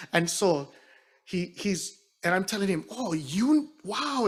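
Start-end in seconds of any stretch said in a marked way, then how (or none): 2.9-4.3 high, amazed gasp of wow; shocked. Retold or imitated. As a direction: sound stunned by the news. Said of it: "And I'm so shocked".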